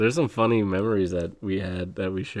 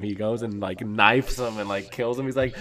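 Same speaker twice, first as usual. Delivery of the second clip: usual speaking voice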